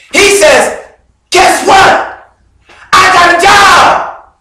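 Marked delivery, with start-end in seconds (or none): 1.3-4.3 excited friend, high and raised; all excited. Acted. Friend coming in to announce his new job, all excited. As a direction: burst in with thrilling news. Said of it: "He's all excited".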